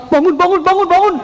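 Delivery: high, rapid repeated call; high-pitched wake-up call; urgent waking call. Acted. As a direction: urgently rouse a sleeper. Imagined waking of sleeping Prophet.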